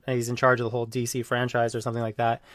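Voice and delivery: ordinary talk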